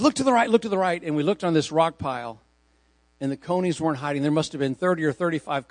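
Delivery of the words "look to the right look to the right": high and fast, urgent pointing; excited guide urging. Retold or imitated. Tour guide urges the bus to look right, excited. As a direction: hurry them to look, lightly imitated.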